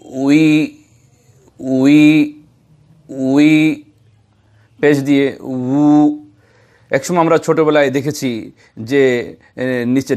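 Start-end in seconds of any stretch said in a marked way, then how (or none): 0.1-6.2 উই said with rounded lips, a little louder; waw with kasra. Shown as a demonstration. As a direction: drill উই deliberately. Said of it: "আর যখন Z দিয়ে বলবো". (গোল ঠোঁটে উই মশক)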